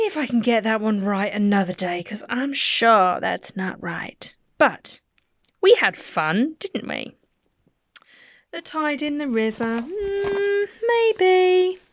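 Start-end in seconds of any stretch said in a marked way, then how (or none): none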